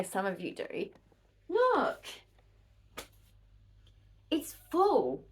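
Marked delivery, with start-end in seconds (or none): 1.5-5.2 high, bright showing off; look, it's full, delighted; eager show-and-tell; excited. Real, not acted. Proudly showing plushie collection.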